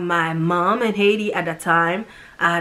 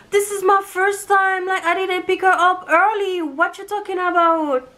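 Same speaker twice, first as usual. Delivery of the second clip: Catherine voiced much higher, pleading in her own defence; defensive Catherine; 11 semitones higher than usual. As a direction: plead your innocence in a raised, hurt voice. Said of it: "Catherine was like".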